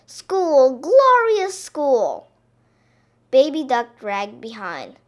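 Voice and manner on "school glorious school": loud, high, drawn-out rejoicing; crying out. A narrator's reading. Parents celebrating the school day, crying out. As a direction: proclaim it grandly, stretching the words. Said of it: "they cried".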